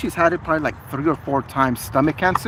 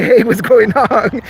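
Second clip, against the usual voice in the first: mock startled; 6 semitones higher, 6 dB louder, slower than usual. Acting out the startled lady.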